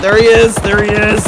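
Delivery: loud, high urgent callout; excited shout. Real, not acted. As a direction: yell to point out the enemy. Spotting the enemy, calling out.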